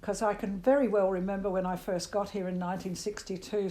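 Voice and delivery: ordinary delivery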